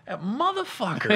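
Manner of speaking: high, laughing resentment; mock envy. Retold envy at seeing post.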